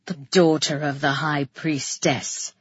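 low, gruff male voice; deep-pitched terse reply; grunted. A narrator's reading. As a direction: grunt out the answer reluctantly. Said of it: "he grunted".

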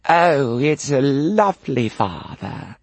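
put-on posh little English boy, drawn out; plummy posh English accent. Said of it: "I was posher then".

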